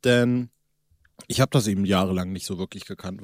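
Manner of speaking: normal talking voice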